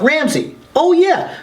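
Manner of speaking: the penny drops all at once; sudden realization. Retold or imitated. Sudden realization hearing the radio.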